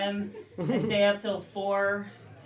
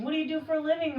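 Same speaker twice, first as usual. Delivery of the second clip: mock eager question again; 6 semitones higher, much faster than usual. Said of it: "is a top question".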